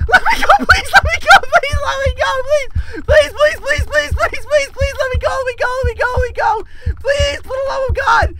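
high, squealing, rapid begging; desperate pleading. Real, not acted. Player begging to be let go, desperate pleading. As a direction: plead frantically in a high whine.

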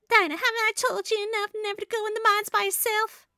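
high Southern mama scolding; Southern accent. Acted. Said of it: "trying my best to talk in a southern accent".

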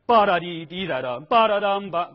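loud, with the stress on the wrong beats in 巴达滴滴哒哒. Shown as a demonstration. Teacher mimics the student's misplaced stress, loud. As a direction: hammer the wrong beats on purpose. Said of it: "就不对了".

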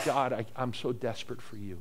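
low, soft, hesitant desperate prayer; broken plea. Retold or imitated. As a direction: pray it brokenly, barely above a murmur. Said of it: "in my brokenness".

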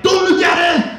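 put-on high, fast squabbling kids' shout; hammed-up kids bickering; sons shouting. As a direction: mimic the boys yelling at each other. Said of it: "my sons are like".

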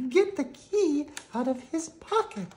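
high, hushed fairy voice; urgent harp. Acted. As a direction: urge him in a high voice. Harp urges.

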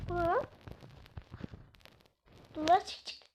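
normal talking voice

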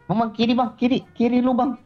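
high-pitched, urgent callout; high, pressing repeated alert; urgent warning. Real, not acted. Warns teammate of enemy left.

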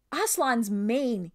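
indignant exclamation. A narrator's reading. King exclaims at his reluctant crew, indignant. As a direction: burst out with drawn-out kingly indignation. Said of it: "he exclaimed".

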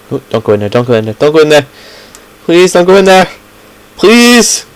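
building to high, loud pleading; freaking out. Real, not acted. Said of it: "I'm freaking out".

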